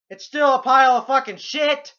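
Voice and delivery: loud, exasperated yell; furious shout. Real, not acted. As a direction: blast the verdict out. Ranting at the movie.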